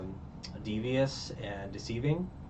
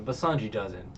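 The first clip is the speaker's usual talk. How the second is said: usual speaking voice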